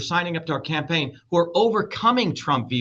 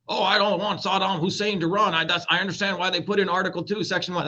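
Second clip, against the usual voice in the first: lightly mimicking people's objection; a hint of mock public chatter; imagined future voters; 3 semitones higher than usual. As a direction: quote the public in a quick run-on voice. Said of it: "That's what people will say".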